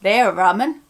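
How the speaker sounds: Suffolk local saying it; broad Suffolk phrase, a touch put on; Suffolk accent. Shown as a demonstration. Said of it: "a Suffolk person would say".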